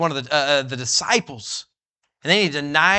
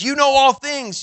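high, louder confession; earnest plea; 9 semitones higher, 5 dB louder than usual. Pretend. Peter pleading with Jesus, loud and earnest. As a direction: pour out the heart. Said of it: "He says".

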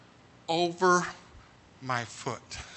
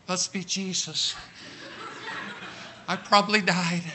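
dazed; 6 dB quieter than usual. Retold or imitated. Waking from anesthesia.

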